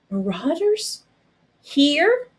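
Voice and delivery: a startled soldier hearing raiders are near; alarmed. Acted. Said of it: "Pincus said with alarm".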